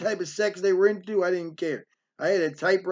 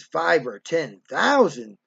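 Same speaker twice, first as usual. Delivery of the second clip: low, slow, disbelieving repeat; drawn-out incredulity; scornful echo; 4 semitones lower, slower than usual. Retold or imitated. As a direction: imitate a mother scoffing at a small figure. Mother scoffs at proposed amount.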